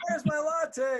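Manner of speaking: high, slow, shouted demand for a latte; hammed-up star yelling for his coffee; mock diva shout. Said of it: "he's like".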